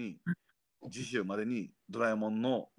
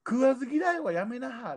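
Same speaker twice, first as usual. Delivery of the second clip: Kyoto accent; 12 semitones higher than usual. Shown as a demonstration. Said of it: "結構京都弁ですよ".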